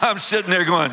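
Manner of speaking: ordinary talk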